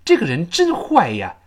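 ordinary talk

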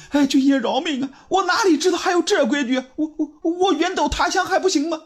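tearful begging, pitched very high; crying. Acted. Young barber begs the officer to spare him, crying. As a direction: stammer and sob for mercy. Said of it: "哭着说".

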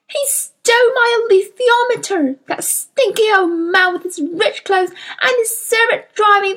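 high, wailing outburst of heartbreak; passionate sobbing. A narrator's reading. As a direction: sob out the grievance as if your heart is breaking. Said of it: "she sobbed so passionately".

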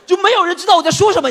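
loud, high, laughing despair; exasperated laugh. Real, not acted. Telling the audience nobody gets him, loud and laughing. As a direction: cry it out in disbelief.

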